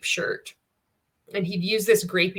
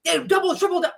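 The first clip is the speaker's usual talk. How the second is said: high shouting hype-man imitation; mock motivational speaker yelling; jacked-up hype; 11 semitones higher, 7 dB louder than usual. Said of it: "He'd get all jacked up".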